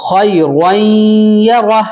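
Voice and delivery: خیرن یرہ chanted with a full-mouthed r and held nasal; full heavy raa. Shown as a demonstration. Teacher corrects the raa, خیرن یرہ with heavy r. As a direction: fill out the r in یرہ after the nasal glide. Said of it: "را پر کرو".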